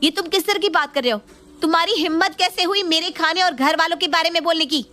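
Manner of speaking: hurt pride, snap back at him; angry retort. Acted. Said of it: "गुस्से में रोहन को सुनाने लगती है".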